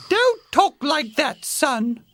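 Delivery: high-pitched mother bear; scolding Mama Bear. A narrator's reading. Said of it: "said Mama Bear".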